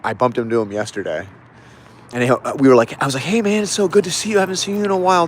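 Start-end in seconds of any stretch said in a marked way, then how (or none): 3.2-5.3 high, eager mock greeting to an old friend; imitating my own excited hello; bright retold greeting. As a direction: lift the pitch way up, happy to see him.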